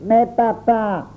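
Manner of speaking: aigu et lent, voix d'enfant qui geint; protestation d'enfant. A narrator's reading. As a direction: supplier son père en traînant. Auguste tente de protester. (enfant qui proteste en geignant)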